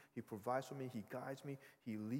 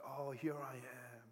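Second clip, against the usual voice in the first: put-on drawn-out greeting to a toddler; good uncle; 3 semitones higher, slower than usual. Uncle approaching his scared niece on the stairs, doting and drawn out. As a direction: stretch the words out like a doting uncle. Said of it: "I wanted to be the good uncle".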